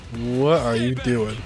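ordinary delivery

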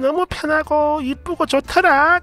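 put-on high, slow, beaming old-mother voice ending in a giggle; delighted mother. Mother on speakerphone praising the shoes, high and delighted. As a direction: play a thrilled mom gushing over new shoes. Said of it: "엄청 좋아하고 계셨거든요".

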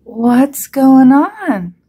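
loud, high, very drawn-out question; startled cry. A narrator's reading. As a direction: cry out bewildered, stretching every word. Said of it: "cried Goose".

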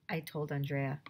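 ordinary delivery, nothing marked

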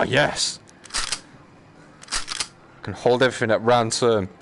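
usual speaking voice

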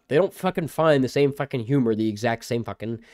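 ordinary delivery

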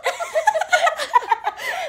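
big high burst of laughter. Real, not acted. Cracking up at friend.

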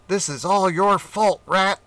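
furious at the rat; angry cat. Acted.

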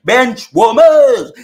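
slow, loud scornful drawl on "Bench warmers"; mocking. Real, not acted. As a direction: stretch it out slowly to mock them. Mocking emphatic repeat.

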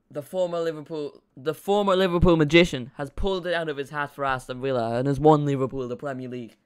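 mock football commentary, loud and grandiose; Peter Drury. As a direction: proclaim the title-winning goal like a commentator. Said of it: "that's a Peter Drury-esque commentary".

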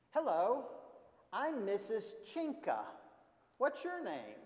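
high-pitched librarian greeting; librarian character. Acted. Missus Chinka greets young Carlo, high librarian voice. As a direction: introduce yourself in a high, projected character voice. Librarian introduces herself to boy.

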